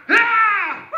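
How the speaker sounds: loud, high throwing yell; battle yell. Acted. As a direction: yell as you hurl a stone. David slings the ball.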